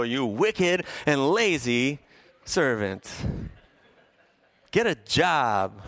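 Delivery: slow, drawn-out mock scolding; mock parental rebuke. Parent mock-scolding a lazy kid, slow and drawn out. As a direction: stretch it out like a fed-up parent. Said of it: "we look at our kids and we go".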